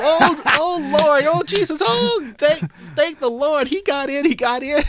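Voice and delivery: high, loud, laughing praise to the Lord; jubilant praise. Retold or imitated. Grandma jumping up over the grandson's news, jubilant. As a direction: jump for joy and thank Jesus. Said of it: "she started jumping up".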